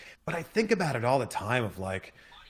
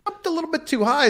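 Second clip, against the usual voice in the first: "jumped a little bit too high" shot up high and fast; rushed, high-pitched emphasis; high emphatic retelling; faster than usual. Retold or imitated. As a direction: fire it off high and quick, stressing the mistake. Repeats the jump line.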